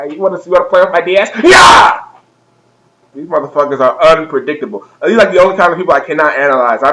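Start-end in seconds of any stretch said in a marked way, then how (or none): 0.0-2.0 hammed-up stabbing war cry; crazed attacker. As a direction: rush the taunt, then explode into a harsh scream. Acts crazed fan attacking with stylus.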